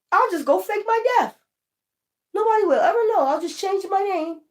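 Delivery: sing-song like a smug crook; high mocking voice of the fakers; mocking smug schemer.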